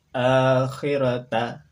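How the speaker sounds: "akhirata" slow and loud, ending ta; model Arabic reading. Shown as a demonstration. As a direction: sound out "akhirata". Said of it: "samakan dengan contoh pelafalan kami". ("akhirata" dibaca pelan, ta marbutah jadi ta)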